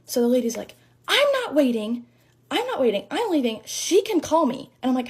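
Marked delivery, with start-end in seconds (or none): 1.1-4.7 high, insistent mock outrage; retold huffy refusal, pitch jumping up; irate customer. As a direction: imitate a customer storming off. Acted angry customer storming off.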